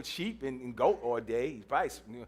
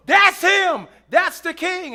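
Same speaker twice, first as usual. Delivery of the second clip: high, loud excited cry; prophet's shout; 10 semitones higher, 10 dB louder, slower than usual. Acted. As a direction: call it out like the prophet spotting the king. Prophet spots David.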